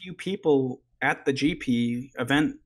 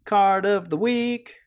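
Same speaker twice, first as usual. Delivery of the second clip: slow, higher drawn-out announce; slow announcer voice; 6 semitones higher, 4 dB louder, slower than usual. Acted. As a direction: stretch the title out grandly. Segment jingle outro.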